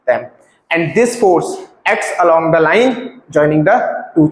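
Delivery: slow, paused recitation of the law; slow dictation. Shown as a demonstration. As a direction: read it out slowly with pauses for students to repeat. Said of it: "pause करके एक बार बोलो". (धीमे रुक-रुक कर बोलना)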